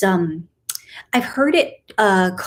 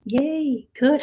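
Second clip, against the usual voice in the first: normal talking voice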